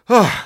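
acted loud gulp of air; gasp for breath. A narrator's reading. Small man recovering his breath, gasping. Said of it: "udělal ještě několikrát".

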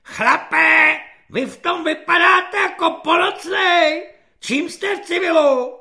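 high, loud drill-corporal shout; ječivý svobodník. A narrator's reading. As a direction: bawl at the new recruit in a screechy, high voice. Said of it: "Povzbuzován ječivým hlasem svobodníka". (ječivý řev svobodníka na nováčka)

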